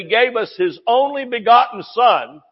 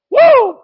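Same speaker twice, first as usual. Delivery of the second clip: high, loud preacher's woo; excited whoop; 9 dB louder than usual. Real, not acted. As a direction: let out a joyful whoop.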